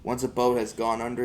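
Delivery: ordinary delivery, nothing marked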